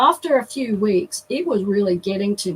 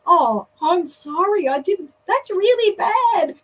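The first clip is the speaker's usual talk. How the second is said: mock sympathy; 8 semitones higher, slower than usual. Feigned sympathy to the girl.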